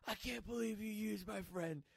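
high-pitched mock furious yell, trailing into babble; imitating furious screaming. Retelling a drunk friend's accusation, mock furious screaming. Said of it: "He starts screaming at me".

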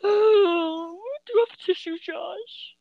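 high, weepy plea; crying. Acted. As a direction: sniffle out the request. Asks for tissue while crying.

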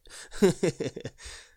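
chuckling at own joke. Real, not acted. Laughs at own pirate joke.

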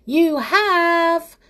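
high, loud, drawn-out contradiction; crying out. A narrator's reading. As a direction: cry it out to contradict him. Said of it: "cried Amelia Jane".

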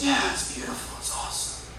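whispered awe, barely voiced; hushed whisper. [name] whispering his answer to the Father in awe. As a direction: breathe it out in a soft whisper.

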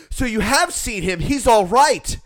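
loud, high mock girlfriend; excited relief. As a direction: imitate a woman seizing on good news.

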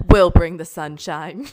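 loud, drawn-out mock declaration; quoted house owner. Joking as a house owner under gray skies, loud playful proclamation. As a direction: proclaim it slowly and loudly. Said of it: "She said".

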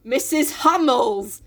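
exasperated. Acted. Beth explaining to self-absorbed Jo, mock exasperation. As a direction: spell it out word by word, hammed-up. Beth's reply acted with exaggerated stress.

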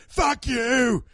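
high, loud outburst; hammed-up British chef scream; Gordon screaming. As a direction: cut them off with a furious shout. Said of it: "He screams".

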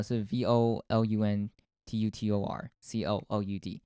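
letter by letter, each letter said separately; spelling it out. Shown as a demonstration. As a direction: spell the name out one letter at a time. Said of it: "怎么拼呢？".

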